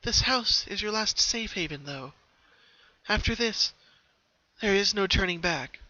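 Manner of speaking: a hint of warning; mildly foreboding young voice; ominous ghost sister. A narrator's reading. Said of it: "her younger sister said ominously".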